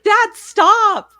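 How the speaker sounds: high, loud whine of an embarrassed daughter; whiny teen protest. Retold or imitated. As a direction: beg dad to stop, mortified. Daughter protesting dad's slang.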